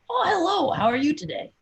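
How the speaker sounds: high, unwarmed morning voice; a voice not yet woken up; croaky morning voice. Acted. Beatrice greeting the party first thing, croaky. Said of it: "Her voice is not warmed up for the day".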